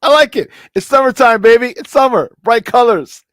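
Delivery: high and loud, summer exclamation; excited exclamation. Real, not acted. Vlogger to viewers about his yellow polo, loud excited exclaiming.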